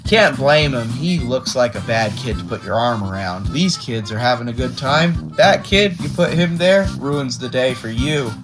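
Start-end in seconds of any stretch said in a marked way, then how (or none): none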